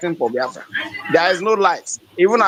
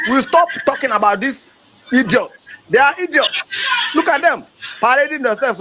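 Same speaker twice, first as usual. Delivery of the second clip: much higher, rising to a shout on idiots; furious shout; 6 semitones higher than usual. Real, not acted. Activist denouncing leaders paraded with Fulani, furious shout. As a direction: lash out at them with contempt.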